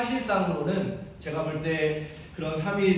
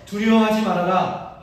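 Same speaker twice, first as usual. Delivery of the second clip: louder, higher quoted command; lifted, emphatic scripture-like quote; the Lord's command; 4 semitones higher, 8 dB louder than usual. Retold or imitated. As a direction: voice the Lord's words, firm and raised. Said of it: "하시는 주님의 말씀".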